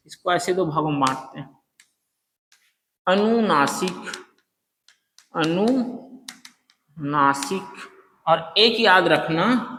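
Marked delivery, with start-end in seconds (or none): 3.1-7.6 अनुनासिक said slowly, syllable by syllable; slow drill. Shown as a demonstration. Teacher drills अनुनासिक slowly for students. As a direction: stretch अनुनासिक out for students to repeat. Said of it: "teacher drills the term".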